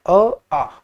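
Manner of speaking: "अ" short and clipped, then "आ" held long; short vs long vowel. Shown as a demonstration. Teacher contrasting vowel length for students: short "अ", long "आ". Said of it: "ज्यादा समय लेकर बोले".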